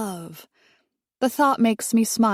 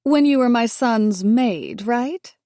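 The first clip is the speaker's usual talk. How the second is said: maid pointed, slow and loud; a pointed jab landing on maid; stress on maid; 4 semitones higher, 4 dB louder, slower than usual. A narrator's reading. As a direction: lean on maid to put her down. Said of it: "emphasizing the word maid".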